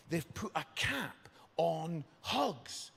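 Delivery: ordinary delivery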